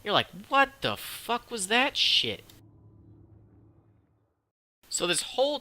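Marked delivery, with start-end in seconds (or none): 0.5-2.4 high, slow, stunned disbelief, played up; mock disbelief. Viewer reacts to the talking dog, hammed-up shocked disbelief. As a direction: stretch it out as an astonished viewer's reaction.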